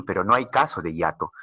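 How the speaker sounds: ordinary talk, nothing marked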